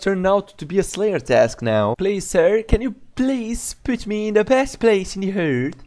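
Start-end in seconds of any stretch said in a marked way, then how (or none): 2.0-5.8 high, pleading put-on NPC voice; mock pleading voice. Gamer voicing an NPC's plea, high and pleading. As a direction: beg like a lowly villager.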